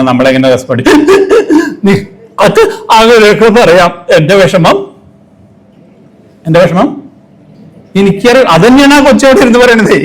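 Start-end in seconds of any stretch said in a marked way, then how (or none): 1.1-1.8 sudden high burst of laughter; amused high laugh. Real, not acted. Laugh before mimicking response. 1.8-10.0 higher aggrieved reply to consoler; upset retort. Retold or imitated. Voicing upset person's retort.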